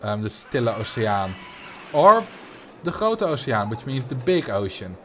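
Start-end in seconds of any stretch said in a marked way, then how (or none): 0.2-1.3 "Stille Oceaan" said the Dutch way, STIL-luh oh-say-AHN; Dutch pronunciation. Shown as a demonstration. Telling chat the Dutch name, in Dutch pronunciation. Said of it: "that's literally what we call it in Dutch". 2.8-3.7 "Grote Oceaan" in Dutch, GHROH-tuh oh-say-AHN with a throaty G; Dutch pronunciation. Shown as a demonstration. Naming the Pacific for chat, in Dutch pronunciation. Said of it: "what we call it in Dutch".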